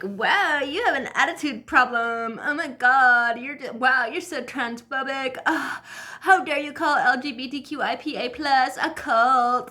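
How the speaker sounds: high, exaggerated scandalized whine; mock outrage. Vlogger mimicking women jumping down her throat, mock outrage. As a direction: imitate offended online commenters, hammed-up. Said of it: "all these women started jumping down my throat, being like".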